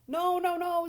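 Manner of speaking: loud, high goose protest; shrieking refusal like a goose. A narrator's reading. Goose warning Wilbur off the pail, loud and high. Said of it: "said the goose".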